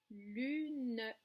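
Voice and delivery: "Lune" drawn out as lu-ne; lu-neuh, measured for clapping; two syllables. Shown as a demonstration. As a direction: stretch "Lune" and sound the final e. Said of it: "connaître le nombre de syllabes".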